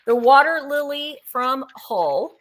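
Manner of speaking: ordinary talk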